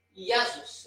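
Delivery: JESUS said as YEH-soos, I sound in place of J; Greek-style "Iesous" for JESUS; Greek pronunciation. Shown as a demonstration. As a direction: open JESUS with a Y glide. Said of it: "eu digo Jesus".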